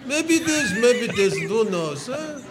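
put-on sing-song Yiddish accent, shrugging it off; hammed-up Yiddish accent. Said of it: "He has a little bit of a Yiddish accent".

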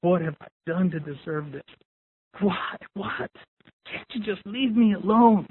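hushed, broken-up despairing questions; quiet crying, imitated. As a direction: softly act out a crumpled victim's plea. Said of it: "crying, saying".

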